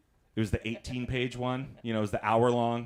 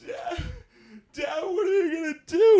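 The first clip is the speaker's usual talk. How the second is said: high, loud pleading yell; daughter's whiny shout; 10 dB louder than usual. Retold or imitated. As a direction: whine it up like a teen girl.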